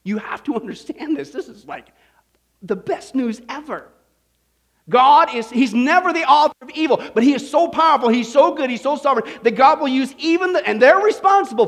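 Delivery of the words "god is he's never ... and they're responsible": loud, higher, hammered proclamation; fervent preaching. Real, not acted. Preacher repeating the truth loudly to the congregation. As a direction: pound every so and sovereign. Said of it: "I'm gonna repeat that again".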